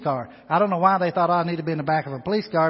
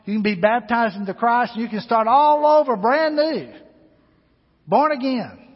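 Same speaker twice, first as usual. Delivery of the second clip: louder and much higher, swelling to the climax; rising preacher's call; 6 semitones higher, 7 dB louder than usual. Acted. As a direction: lift the invitation to a peak. Climax of the invitation.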